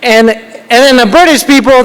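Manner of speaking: ordinary talk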